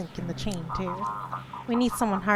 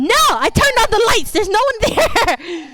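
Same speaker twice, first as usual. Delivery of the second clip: high loud frightened shouting; panicked yell; 14 semitones higher, 11 dB louder than usual. Real, not acted. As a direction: scream in real fright. Scared by game voice.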